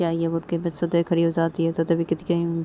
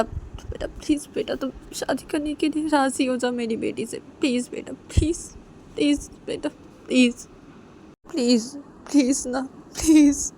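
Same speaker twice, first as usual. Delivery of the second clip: acted tearful mother, begging in broken bursts; tearful pleading; 8 semitones higher, 3 dB louder than usual. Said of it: "आंसू गिरने लगते हैं". (रुआँसी होकर मिन्नत करना)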